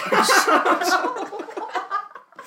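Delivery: high, loud laughter. Real, not acted. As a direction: crack up loudly. Laughing at sweat question.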